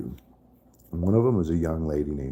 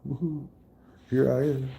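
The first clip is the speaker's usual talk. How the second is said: mock cheer; 9 semitones higher than usual.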